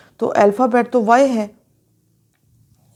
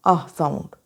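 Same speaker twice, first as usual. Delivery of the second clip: normal talking voice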